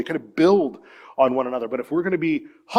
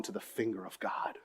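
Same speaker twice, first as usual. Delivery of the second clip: whisper; 6 semitones lower, 17 dB quieter than usual. Real, not acted. Tender childhood memory.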